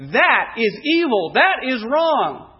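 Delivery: loud, slow, emphatic denouncing; angry condemnation. Acted. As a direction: pronounce judgment, high and forceful, word by word. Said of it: "was to him evil. He looked at the word".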